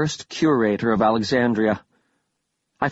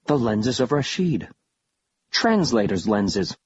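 usual speaking voice

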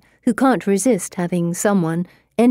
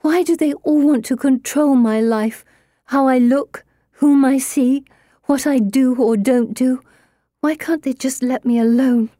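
put-on higher, louder, exasperated young woman; upset girl voice; 7 semitones higher, 4 dB louder than usual. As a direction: plead against being controlled. Pippa protesting her arranged marriage.

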